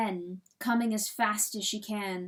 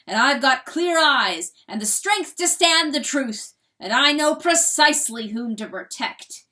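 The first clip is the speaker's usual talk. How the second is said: louder, proclaimed like a decree; loud crowing decree; 3 semitones higher, 10 dB louder than usual. A narrator's reading. Rooster declaring his creed to hen, loud decree. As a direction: crow it out as law for all to hear. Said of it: "declares in a loud crow".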